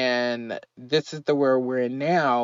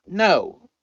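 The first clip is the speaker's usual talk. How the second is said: ordinary delivery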